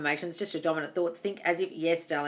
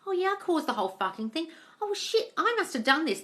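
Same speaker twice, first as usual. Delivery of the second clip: realizing; 8 semitones higher than usual. Retold or imitated. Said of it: "start looking at your life and thinking".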